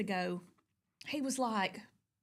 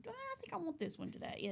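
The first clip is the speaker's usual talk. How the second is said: high, soft musing to herself; dreamy self-talk; 12 semitones higher, 11 dB quieter than usual. Retold or imitated. Choosing a blanket for the day, softly high and dreamy. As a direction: coo it like picking a favorite blanket. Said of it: "trade it out".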